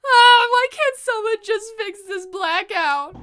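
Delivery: loud high wail of frustration; frantic exasperation. Acted. Trapped student rails at the blackout, frantic exasperation. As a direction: burst out on the first sound, desperate.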